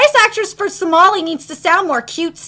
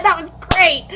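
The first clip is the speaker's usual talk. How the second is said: usual speaking voice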